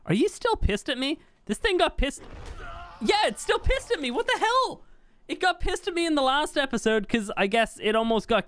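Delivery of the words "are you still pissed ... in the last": climbing higher and higher in exasperation; can't believe the creature is still mad; rising, incredulous complaint; exasperated disbelief. Real, not acted. Pet creature still angry at him.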